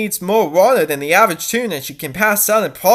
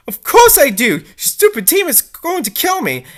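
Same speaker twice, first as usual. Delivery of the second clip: angry retort; 8 semitones higher, 4 dB louder than usual. Acted. Dee Dee argues with Voice.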